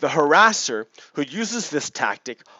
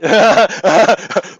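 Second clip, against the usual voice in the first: high burst of laughter; loud laugh; 6 semitones higher, 9 dB louder than usual. Real, not acted. Seeing the supremacist's photo, laughing loudly.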